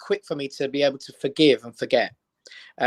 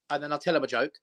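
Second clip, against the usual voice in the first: usual speaking voice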